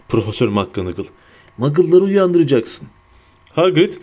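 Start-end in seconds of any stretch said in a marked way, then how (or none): none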